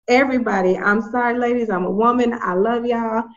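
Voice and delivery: ordinary delivery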